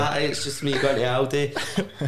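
slow, sheepish hello through a laugh; awkward laughing greeting. Retold or imitated. To a fan's friend on FaceTime, sheepish, slow and laughing. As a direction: laugh it out like you got caught off guard.

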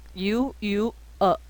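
"U U呃" pushed from the throat, slow; slow throaty "呃"; throaty uh sound. Shown as a demonstration. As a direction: force "呃" out from the throat. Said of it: "卡在喉咙".

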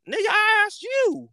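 high, far-off squeaky retort; mock indignant squeal. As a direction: snap back in a high put-on voice. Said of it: "I was like".

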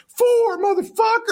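high-pitched, drawn-out cocky cry; hammed-up bragging exclamation; acted boast. Acted boast in retold exchange.

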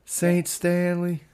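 slow, high mock reverence; drawn-out fake worship; mock reverent. Mocking reverent title.

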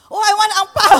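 high, loud and laughing; laughing mum voice. Retold or imitated. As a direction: mum laughing, suddenly eager.

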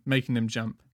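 plain reading voice

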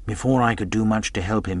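ordinary delivery, nothing marked

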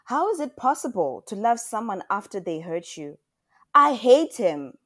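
high, loud outburst, building; angry protest. A narrator's reading. Daughter refuses to love the man who hurt her, angry protest. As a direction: burst out with hurt anger, slowing on the last words.

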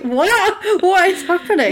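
very high, hammed-up disbelief; mock incredulous wife. Wife hears husband forgot his brother, mock incredulous. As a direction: shoot the pitch up, imitating shock.

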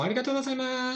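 high, soft mock Japanese accent; imitated Japanese accent. Shown as a demonstration. Showing how Japanese people talk, high imitated Japanese accent. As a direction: put on a light, high Japanese lilt. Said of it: "los japoneses como".

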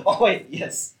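bursting into a loud, high laugh as it clicks; laughing realization. Real, not acted. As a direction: laugh out the sudden aha. Sudden realization mid laugh.

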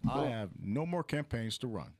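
Obama impression.

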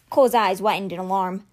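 usual speaking voice

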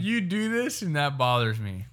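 high-pitched opening, dropping at the end; sing-song catchphrase. Pretend. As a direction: pitch it like a segment jingle. Trying out segment catchphrase.

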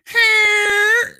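very high loud cackling laugh; shrieking laugh. Real, not acted.

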